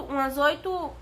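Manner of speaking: ordinary delivery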